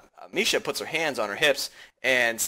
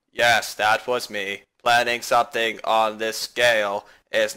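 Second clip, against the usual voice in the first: composed classmate; 7 semitones lower, 3 dB quieter than usual. Acted. Classmate answering criticism of the planning, low and composed. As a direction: explain it evenly in a low register.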